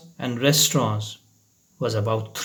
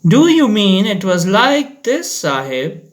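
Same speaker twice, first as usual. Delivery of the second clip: eerie watchman; 6 semitones higher, 4 dB louder than usual. A narrator's reading. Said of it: "asked the watchman".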